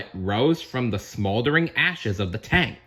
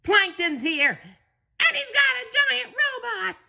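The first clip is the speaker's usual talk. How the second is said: much higher urgent sponge voice; SpongeBob voice. Acted. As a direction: blurt out the alarming news. SpongeBob warns Mr Krabs.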